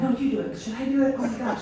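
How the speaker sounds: lightly voiced teammates egging each other on; players' chitter-chat. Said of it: "hearing some chitter chat with some of the players".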